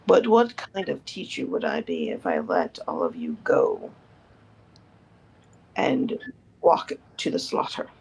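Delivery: put-on low, grave old mentor; old teacher voice. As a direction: speak as a weary old teacher.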